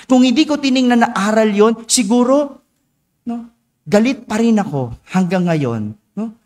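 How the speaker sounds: ordinary delivery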